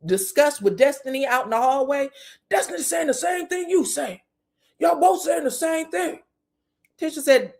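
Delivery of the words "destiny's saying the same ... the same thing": higher-pitched mock accusation; acted retort. Recapper voicing a woman at the table, accusing. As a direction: call them out in a raised retold voice.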